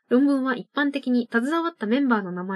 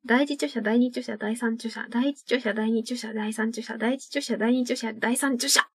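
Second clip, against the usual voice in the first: three quick rounds, just slightly sluggish; fast tongue twister. Shown as a demonstration. Host's final go at a listener's tongue twister, rapid recitation. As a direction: rattle off the titles three times without a break. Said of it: "これを早口で三回読んでください".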